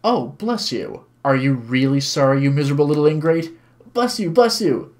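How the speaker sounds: animated. Acted. Older man and woman talk excitedly, animated. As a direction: act out an animated exchange. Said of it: "speaking with animation".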